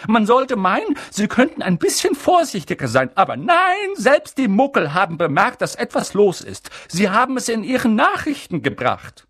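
high, loud reproach; scolding McGonagall. Acted. McGonagall complains to Dumbledore, loud and reproachful. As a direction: scold the careless wizards.

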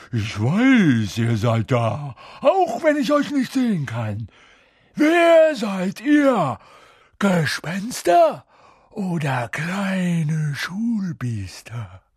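high, loud, drawn-out goading; mischievous poltergeist, suspicious and loud; taunting poltergeist. A narrator's reading. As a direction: taunt them in a high, stretched voice.

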